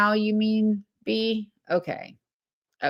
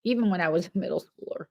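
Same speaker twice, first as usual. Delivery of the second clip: ordinary talk, nothing marked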